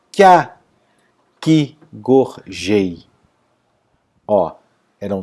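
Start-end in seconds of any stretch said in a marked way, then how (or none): 0.1-3.0 fuse "que a", then beat out the rest up to "jei"; merged syllable. Shown as a demonstration. Teacher showing one syllable, "que a" merged, then syllable by syllable. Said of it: "a gente lê uma sílaba só".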